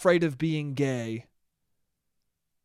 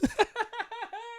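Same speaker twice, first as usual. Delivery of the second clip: soft high laugh; 8 dB quieter than usual. Real, not acted. Laughing at his own joke.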